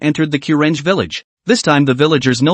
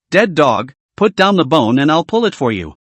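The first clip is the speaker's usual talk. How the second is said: plain reading voice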